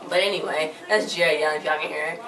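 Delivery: normal talking voice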